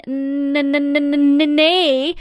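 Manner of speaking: stuck repeatedly on the N of "nee"; stuttering. A narrator's reading. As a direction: stutter n-n-n before finally getting "nee" out. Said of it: "proef wat te stummeren". (stottren op "nee")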